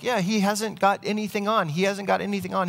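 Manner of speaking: high-pitched voices of onlookers agreeing, not shouted; crowd chiming in. Acted. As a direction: echo the boy as the crowd catches on. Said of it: "other people are like".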